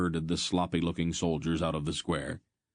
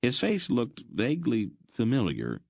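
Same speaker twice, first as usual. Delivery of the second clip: ordinary delivery, nothing marked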